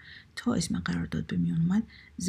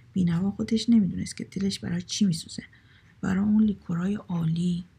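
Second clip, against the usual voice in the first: ordinary delivery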